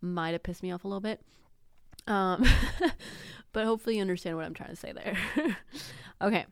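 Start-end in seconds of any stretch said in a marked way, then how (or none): 2.5-3.5 breathy, high burst of laughter; high airy laugh. Real, not acted. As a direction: laugh it off, a bit embarrassed. Laughs at own admission.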